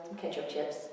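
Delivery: ordinary delivery, nothing marked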